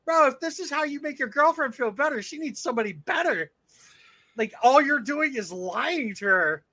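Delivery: scolding, drawing out the last line; indignant. Retold or imitated. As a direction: reenact telling him off, exasperated. Retold argument with lying fan.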